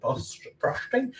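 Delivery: frosting asked on a high, slow rise; puzzled question. Real, not acted. Baker unsure what to call buttercream, puzzled rising question. Searching for the right word.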